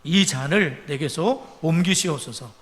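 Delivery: loud, solemn Bible reading from the pulpit; preacher reading the Gospel aloud; scripture reading. Acted. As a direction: proclaim the verses, slow and loud. Reads Gethsemane scripture aloud.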